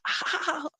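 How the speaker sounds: high, soft laugh; a short burst of high laughter. Real, not acted. Laughs at sister's message.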